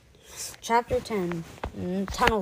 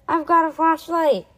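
raised, higher calling voice; shouting; 7 semitones higher, 7 dB louder than usual. A narrator's reading. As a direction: call out to a friend at a distance. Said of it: "he shouted".

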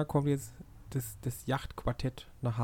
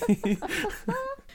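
very high shriek of laughter; high squealing laugh. Real, not acted. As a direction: let out a piercing squeal of laughter. Laughing after a joke.

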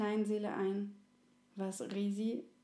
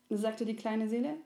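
usual speaking voice

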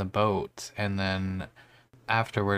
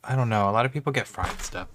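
ordinary talk, nothing marked

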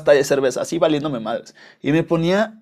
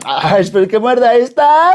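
higher, louder, slow teasing voice; mock double-entendre joker; 7 semitones higher, 8 dB louder than usual. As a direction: ham it up like a joker making a dirty pun. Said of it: "y albureros, no empiecen".

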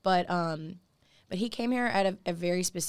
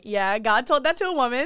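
excited; 6 semitones higher, 9 dB louder than usual. Retold or imitated. Excited quote of herself.

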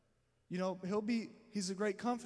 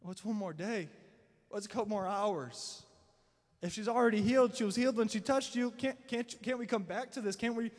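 urgent pleading father; 3 semitones higher, 5 dB louder than usual. Retold or imitated. Jairus pleading with Jesus.

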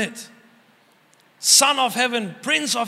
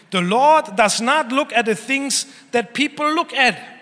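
ordinary talk

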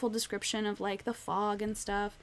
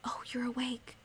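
soft breathy whisper, not to wake the house; 3 semitones higher, 8 dB quieter than usual. Retold or imitated. Miles wakes Winnie at dawn, whispering. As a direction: hush it like someone might still be asleep. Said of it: "he comes over and whispers".

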